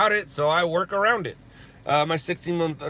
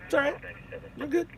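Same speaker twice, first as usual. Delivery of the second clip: much higher and softer, soothing; soothing hush; 11 semitones higher, 9 dB quieter, faster than usual. Shown as a demonstration. Soothing his baby son in his armpit, soft and high. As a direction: coo to a crying baby held close. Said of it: "and just go".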